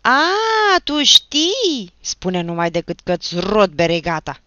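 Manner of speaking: loud roar, starting very high; enraged yell, pouncing on the speaker; furious roar. A narrator's reading. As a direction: bellow a savage threat. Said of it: "răcni Achim iar".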